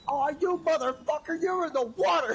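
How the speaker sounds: high-pitched mock outburst; mock outrage. Retelling a friend's outburst at being tricked, high and mocking. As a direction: squeal it like you just got tricked.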